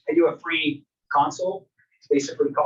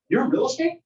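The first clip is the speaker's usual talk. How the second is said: curious customer; 9 semitones higher than usual. Retold or imitated. Customer in store asks agent.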